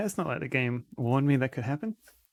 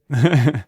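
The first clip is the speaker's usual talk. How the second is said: loud laugh; 2 semitones higher, 13 dB louder than usual. Real, not acted. Laughs at the song.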